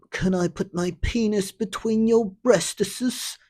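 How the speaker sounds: high, mock sleazy old man; lightly mocked old man asking; creepy old man. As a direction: ask it like a leering old creep. Quoting the old man's requests.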